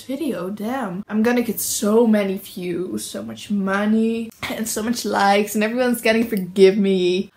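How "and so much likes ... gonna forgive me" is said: ordinary talk, nothing marked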